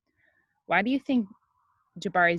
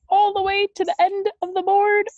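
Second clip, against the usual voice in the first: high, loud thrilled build-up; dramatic suspense; 14 semitones higher, 8 dB louder than usual. A narrator's reading. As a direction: build the tension to a gasp. Suspense at the board's end.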